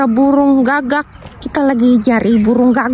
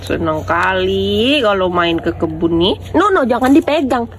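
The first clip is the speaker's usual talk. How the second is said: ordinary talk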